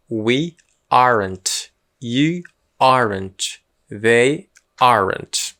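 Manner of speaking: very slow We aren't, They aren't, each word drawn out; slow pronunciation drill. Shown as a demonstration. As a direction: spell the sound out slowly for learners. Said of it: "Pronúncia".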